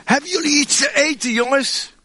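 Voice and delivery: Jesus calls from the shore to the boat; calling out. Acted. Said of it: "Hij riep". (roepend over het water, hoger)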